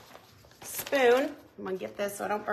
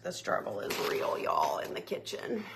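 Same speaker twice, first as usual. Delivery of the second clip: whispered aside; 13 semitones lower, 8 dB quieter than usual. Real, not acted. Whispered complaint while cooking.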